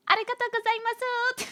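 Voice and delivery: squeaky-high, exaggerated thank-you; high-pitched Japanese. Shown as a demonstration. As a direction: push it way up into a tiny high voice. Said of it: "make you wanna go high pitch".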